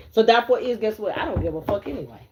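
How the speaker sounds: usual speaking voice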